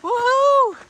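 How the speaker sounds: high, loud cheer of greeting; excited whooping shout. Real, not acted. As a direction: whoop and holler to friends down the road. Spots friends at trail magic.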